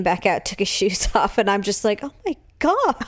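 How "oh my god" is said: high, appalled oh my god; disgusted surprise. Retold or imitated. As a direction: recoil at the stink. Mother reacting to smelly feet.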